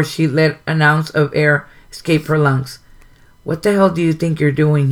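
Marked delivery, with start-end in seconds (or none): none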